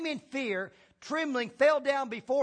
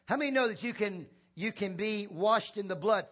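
ordinary talk, nothing marked

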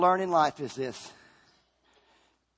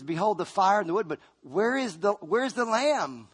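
Isaac asking; 7 semitones higher than usual. Acted. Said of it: "He says".

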